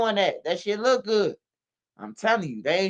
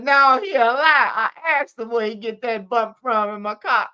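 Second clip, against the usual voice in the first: higher and louder put-on mother's denial; indignant mama; 5 semitones higher, 4 dB louder than usual. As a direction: fire back like an offended mom. Mama denying again, imitated.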